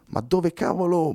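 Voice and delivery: high, slow, dazed voice from the back; waking man mumbling confused; groggy mumble. Acted. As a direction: mumble as if half awake. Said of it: "blaterò una voce dal fondo".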